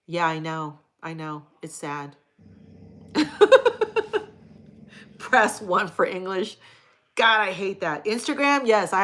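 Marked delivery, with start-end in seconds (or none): 3.1-4.3 a burst of loud, high laughter. Real, not acted. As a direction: let out a big high laugh. Laughs at her own joke.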